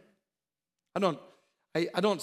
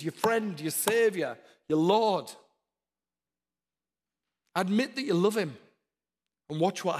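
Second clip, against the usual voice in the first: louder and higher, punchy repeated calls; rising preaching; 4 semitones higher, 4 dB louder than usual. Real, not acted. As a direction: drive each "Get" home to the congregation. Preaching at full force.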